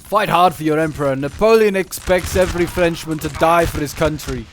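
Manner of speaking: loud rallying cry in a hammed-up French accent; mock French accent. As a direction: bellow like a French officer rousing his troops, vague French accent. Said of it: "Don't know what accent that was supposed to be".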